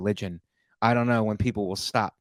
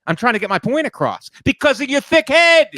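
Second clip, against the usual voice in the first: loud and higher, building to a shout; shouting at girlfriend who says stop yelling; retold yelling; 9 semitones higher, 7 dB louder than usual. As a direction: yell back defensively. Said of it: "like, yelling".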